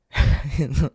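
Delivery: short, loud burst of laughter; real laugh. Real, not acted. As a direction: crack up mid-story. Laughing at own story.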